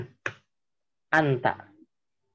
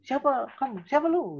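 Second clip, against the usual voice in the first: much higher-pitched, confrontational demo; rude challenge; 10 semitones higher than usual. Shown as a demonstration. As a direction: demand it as if squaring up to someone. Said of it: "Itu jangan kayak gitu ya". (nada tinggi menantang)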